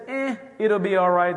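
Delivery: a careless, prideful shrug of a line; cavalier brush-off. Retold or imitated. Preaching to the congregation about ignoring the Spirit, mock cavalier. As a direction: wave the warning away like it's nothing. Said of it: "this cavalier, prideful attitude".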